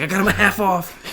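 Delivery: high, frantic mock announcement; manic energy. Showing up to the house with Nikes, manic energy. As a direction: burst in with frantic high-pitched news. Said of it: "that's the energy I imagine he showed up".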